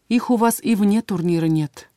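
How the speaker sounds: plain reading voice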